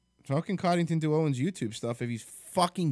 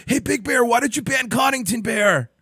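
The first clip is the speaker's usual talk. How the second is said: mock caller voice; 10 semitones higher, 6 dB louder than usual. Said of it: "So people are like".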